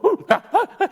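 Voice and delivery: high, loud eager dog yelps; mock excited hunting dog; excited dog whimpers. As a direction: yelp like a dog itching to hunt. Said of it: "them dogs be like".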